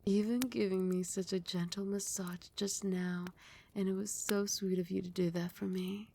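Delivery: a doting, possessive queen toying with her pet; a mistress praising her obedient pet, teasing and fond. Acted. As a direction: purr it like a queen who owns him. Mistress voice held throughout.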